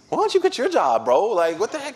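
high-pitched mock indignation; indignant retort. Preacher answers brother about his job, high indignant retort.

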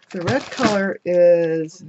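talking over a loud punching tool; over punching noise. Real, not acted. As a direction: speak while punching paper. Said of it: "excuse the punching".

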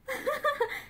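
short, high, soft laugh; light acted titter; nervous laugh. A narrator's reading. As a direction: let out a small nervous giggle. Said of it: "Esther laughed".

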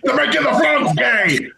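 high-pitched conspiracy ranter; Alex Jones. Acted. Host mimicking a famous promo about frogs, high ranting impersonation. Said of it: "Alex Jones".

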